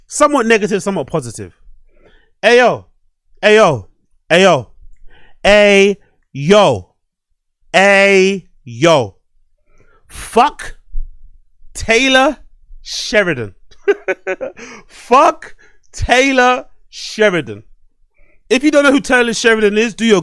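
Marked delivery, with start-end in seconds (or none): 2.4-9.1 loud, punchy Ayo shouts, over and over; loud ayo calls. Acted. Host hyping his audience, loud repeated calls. 10.1-17.6 drawn-out slow scornful curse, higher; mock dramatic curse. Fan cursing the showrunner, slow and scornful.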